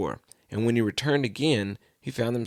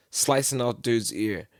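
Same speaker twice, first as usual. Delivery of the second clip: usual speaking voice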